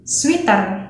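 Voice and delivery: 'sweater' with EE in the middle; Indonesian-style 'sweater', like 'sweet' plus a hard T; Indonesian accent. Shown as a demonstration. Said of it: "in Indonesian accent".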